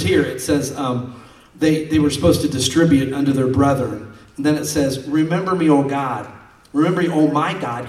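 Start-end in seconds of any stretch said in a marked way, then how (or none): 5.1-7.9 scripture reading. Acted. Reads scripture aloud.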